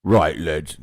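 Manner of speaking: loud orc rallying the lads. Pretend. Co-host asked for his orc voice, loud orc voice. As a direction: boom it out as an orc. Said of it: "could you put on your orc voice?".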